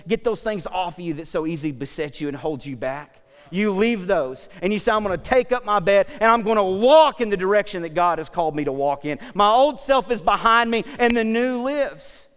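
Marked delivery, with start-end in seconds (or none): 3.5-12.3 loud, high, ringing declaration; preaching at full force. Acted. Preacher declaring the old self left behind, full-force preaching. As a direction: proclaim it boldly to the whole room.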